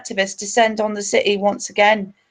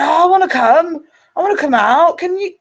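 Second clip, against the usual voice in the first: higher, louder eager lad's pleading; naive young lad; 7 semitones higher, 4 dB louder than usual. Retold or imitated. Young lad begging to come out with the group, imitated eagerly. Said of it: "um, who's twenty-one years old, quite naive".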